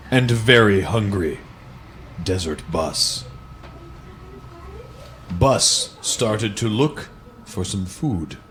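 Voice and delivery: slow, deliberate picture-book read-aloud; lingering on each line like bedtime reading; storybook narration. Acted. Reading a parody children's book to friends, in a slow storybook voice.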